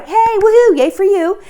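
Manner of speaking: rising into a loud, high cheer. Real, not acted. Coach cheering on parents, loud and high. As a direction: whoop it up for the parents.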